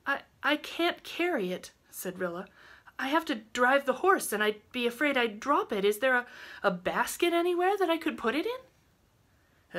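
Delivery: put-on high, halting, worried young voice; hesitant girl. A narrator's reading. Rilla asks for a basket to carry the baby, hesitant. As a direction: stumble through the request, pitched high.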